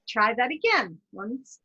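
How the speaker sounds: ordinary delivery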